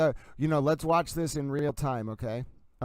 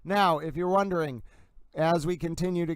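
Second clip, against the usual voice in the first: hyped replay call, pitched up; frantic mock sports commentary; 4 semitones higher than usual. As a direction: call each frame like a highlight.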